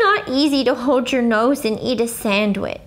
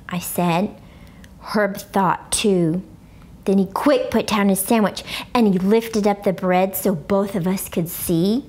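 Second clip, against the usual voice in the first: ordinary delivery, nothing marked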